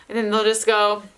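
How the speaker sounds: usual speaking voice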